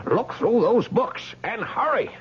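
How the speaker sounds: high, pressing command; urgent plea. Acted. Man sending woman to search books, urgent plea. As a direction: urge it on with rising desperation. Urging woman to find answer.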